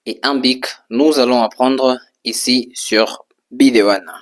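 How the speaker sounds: normal talking voice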